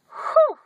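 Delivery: high breathy whew of relief; relieved whew. Real, not acted. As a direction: let out a high sigh of relief. Relief as the preacher turns away.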